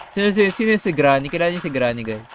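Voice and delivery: ordinary talk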